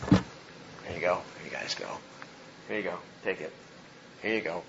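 low, hushed, offhand murmur; softly, as if passing plates along; quiet handing-out. Acted. Jesus handing food to the disciples, quietly matter-of-fact.

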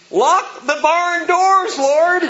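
high, loud, slow hammed-up plea; mock desperate prayer. Pastor acting his own prayer to withdraw, high, slow mock plea. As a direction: beg the Lord to shut everyone out. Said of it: "And I hear that and I say".

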